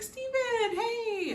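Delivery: high, drawn-out tipsy call; mock drunk greeting. Drunk Lucinda greets Steven, mock tipsy and high. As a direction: imitate a tipsy sing-out hello.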